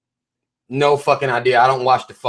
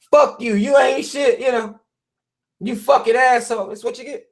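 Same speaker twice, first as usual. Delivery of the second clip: higher, indignant mock cursing; mock offended friend; 8 semitones higher than usual. Insulted friend cursing out the honest guy, mock outrage. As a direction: lash back like someone insulted.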